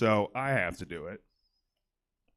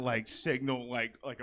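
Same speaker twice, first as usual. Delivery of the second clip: ordinary talk, nothing marked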